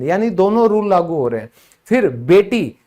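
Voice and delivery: ordinary talk, nothing marked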